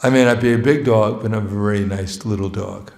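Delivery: ordinary talk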